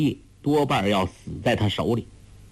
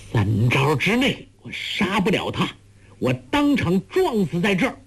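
vehement vow climbing high; crying out; 7 semitones higher than usual. A narrator's reading. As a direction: swear it in a heated cry. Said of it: "梅超风大叫".

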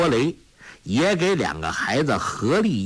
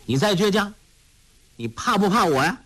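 bully's sharp demand, higher; barking; 7 semitones higher, faster than usual. A narrator's reading. Dushi slaps Tolui and threatens him, barking. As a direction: threaten the smaller boy. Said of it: "喝道".

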